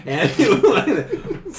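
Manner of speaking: words broken by laughter; laughing. Real, not acted. Laughs mid-description.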